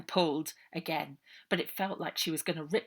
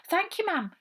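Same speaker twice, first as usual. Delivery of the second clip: higher, louder polite thanks; polite girl; 12 semitones higher, 8 dB louder than usual. A narrator's reading. Girl thanking the matron, polite and higher.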